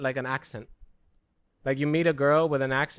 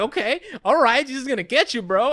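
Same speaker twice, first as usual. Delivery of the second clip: mock alarm; 9 semitones higher, 5 dB louder than usual. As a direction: play it scared. Mock reaction to scary rap.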